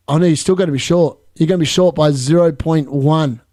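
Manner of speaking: ordinary delivery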